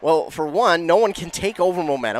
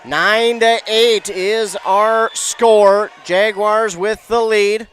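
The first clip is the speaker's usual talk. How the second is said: slow, higher, drawn-out score announcement; announcer score call; 4 semitones higher, 5 dB louder, much slower than usual. Real, not acted. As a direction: announce the score slowly, stretching each word. Commentator announces the close score.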